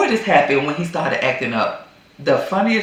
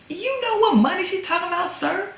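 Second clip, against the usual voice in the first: high, fast mock-sassy challenge; sassy wife; 14 semitones higher, faster than usual. Wife confronting husband about the money, high sassy challenge. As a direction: call him out with a high fast sneer.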